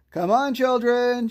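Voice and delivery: high, loud call; holler. A narrator's reading. Aunt Dotty calling the children to tea, loud holler. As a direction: call the children across the garden. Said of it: "she hollered".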